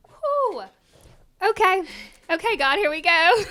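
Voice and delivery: high, loud breathless relief before a big moment; nervous relief. Retold or imitated. Retelling her reaction to the donation news, high and loud. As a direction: let it out like bracing for a miracle. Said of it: "And I was like".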